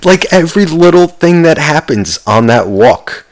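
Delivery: usual speaking voice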